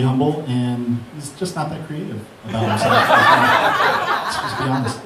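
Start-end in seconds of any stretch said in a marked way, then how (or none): 3.1-4.2 sudden high laugh. Real, not acted. Laugh at humble Mark.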